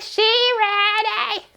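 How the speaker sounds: high, very slow savoring drawl; drawn-out hype. Retold or imitated. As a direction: stretch it out like you're impressed. Her own reaction at her desk.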